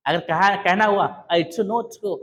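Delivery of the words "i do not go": British-style I DO NOT GO, NOT as rounded "not"; taught British tone. Shown as a demonstration. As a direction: model I DO NOT GO with a British lilt. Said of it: "जो tone बताया गया है उस tone में चलो". (British tone में I DO NOT GO, "नॉट" के साथ)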